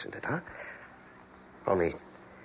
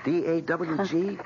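spelled out; 10 semitones higher, 3 dB louder, much slower than usual. Acted. Spelling name slowly, amused.

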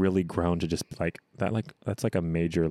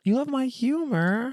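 mock surprise; slower than usual. Teasing disbelief.

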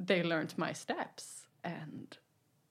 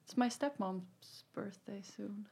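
whispered; 7 dB quieter than usual. Real, not acted. Whispered aside.

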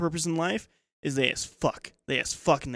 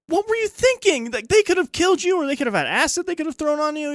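much higher, louder, panicky telling-off; alarmed scolding date; 14 semitones higher, 5 dB louder than usual. Retold or imitated. Date scolding him after he fought purse thieves, high alarmed voice. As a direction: shriek at him as if he nearly died.

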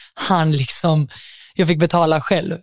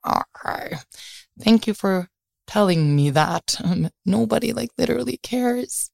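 sarcastic aside; 4 semitones higher, 3 dB quieter than usual. Retold or imitated. Inre sarkastisk kommentar, viskat.

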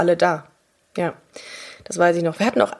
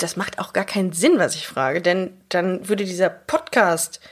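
normal talking voice

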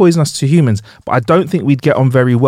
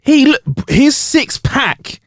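very high, amazed outburst; real astonishment; 9 semitones higher, much slower than usual. Real, not acted. Host amazed at the chef's physique, high-pitched astonishment. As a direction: exclaim high in disbelief.

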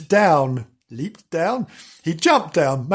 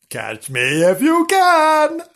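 high, slow playful taunt; teasing kitten; 12 semitones higher, 4 dB louder, slower than usual. Acted. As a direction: dare him to chase you. Kitten teases the puppy.

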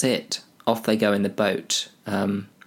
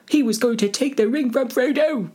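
very high falsetto cry; squeaky accuser; 3 dB louder than usual. Acted. Someone accuses Boromir, high-pitched comic voice. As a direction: pipe it up in a tiny high voice.

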